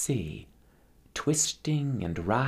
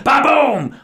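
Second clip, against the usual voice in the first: thunder boom; 13 dB louder than usual. Acted. Thunder from the cloud.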